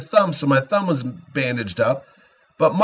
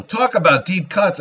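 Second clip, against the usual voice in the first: ordinary talk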